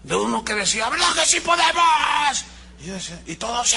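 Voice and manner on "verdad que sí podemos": high, loud rallying yell; mock motivational shout. Preacher imitating a coach's rally shout. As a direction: whip up the staff like a coach. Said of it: "escuché gritos desaforados".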